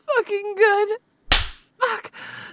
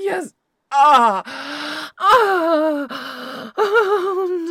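climaxing; 3 semitones higher, 7 dB louder than usual. Acted. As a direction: build up to a loud climax and let it break. Lover climaxing during sex.

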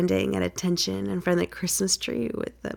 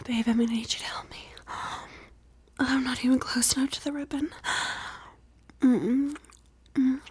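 soft teasing whisper; breathy ASMR whisper; 5 semitones higher, 4 dB quieter than usual. Acted. Whispered roleplay.

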